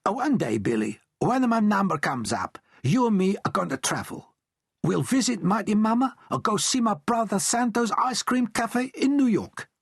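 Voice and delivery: high, excited Italian father promising travel; Italian dad voice, dreaming. A narrator's reading.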